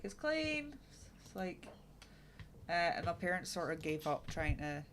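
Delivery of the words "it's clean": high-pitched mock innocence; retold childish insistence. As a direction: imitate a kid claiming the job is done.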